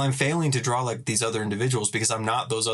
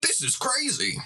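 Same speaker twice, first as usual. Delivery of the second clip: much higher, amazed mock voice; mocking Elon Musk; 9 semitones higher than usual. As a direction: gush like a dazzled fanboy. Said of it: "Elon would tweet about and be like".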